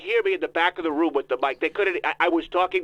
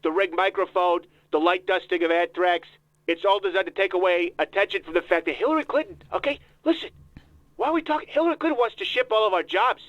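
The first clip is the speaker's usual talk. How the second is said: put-on voice of a self-important politician; Donald Trump voice. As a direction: interrupt like a pompous candidate. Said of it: "Mister Trump".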